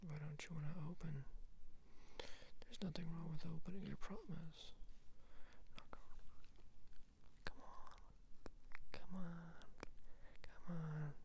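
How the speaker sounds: hushed whisper, sweet-talking the stuck lid; coaxing whisper. Acted. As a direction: whisper gentle encouragement to a jammed box. Whispers, coaxing a stuck item open.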